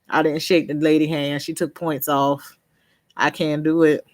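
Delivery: usual speaking voice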